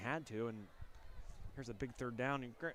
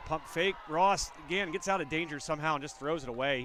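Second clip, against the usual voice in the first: excited play-by-play; 3 semitones higher, 8 dB louder than usual. Real, not acted. Live play call.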